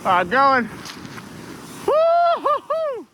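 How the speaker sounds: whooping on the way down; sledding downhill. Real, not acted. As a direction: let out a long whoop. His own sled run.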